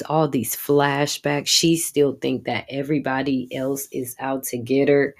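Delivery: normal talking voice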